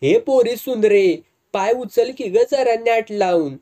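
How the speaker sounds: high, loud call across the distance; shouting after the girl. Acted. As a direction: yell at the lagging girl to hurry. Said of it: "ती ओरडली".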